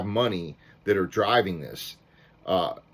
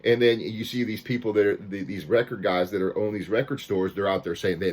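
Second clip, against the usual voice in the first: normal talking voice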